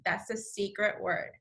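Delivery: ordinary delivery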